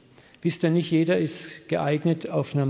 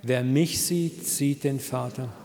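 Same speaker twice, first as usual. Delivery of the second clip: slow, measured phrases for repeating; group recitation; slower than usual. Shown as a demonstration. Preacher leading the congregation in saying it together, slow recitation. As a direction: lead the room through it slowly. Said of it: "Können wir das mal miteinander sagen".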